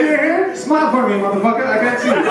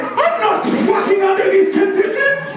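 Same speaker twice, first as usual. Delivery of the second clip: much higher, laughing mock outrage; outraged fussy guy; 14 semitones higher than usual. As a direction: squeal it like a prissy neat freak. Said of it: "is like".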